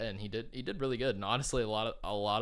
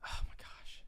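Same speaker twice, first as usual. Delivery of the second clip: whispered to herself; 6 dB quieter than usual. Real, not acted. Frustrated, can't recall the name.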